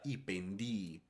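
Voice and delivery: "pendii" ending in a stressed, lengthened i, pen-DIII; long double I. Shown as a demonstration. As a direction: hold the final double i of "pendii" a little longer. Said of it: "Se tiene que pronunciar un poco más largo". ("pendii" con doble i final larga y acentuada)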